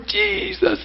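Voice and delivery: high, swooning call of Jesus; mock the adoring ladies; gushing worshipper. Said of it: "they're all in the room".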